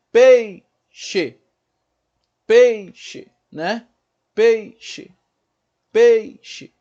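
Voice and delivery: PEI forte e mais agudo, xe fraco; o E de "peixe" com força, o I sumindo. Shown as a demonstration. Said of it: "Uma vogal a gente fala com mais força". ("peixe" em duas batidas, E destacado)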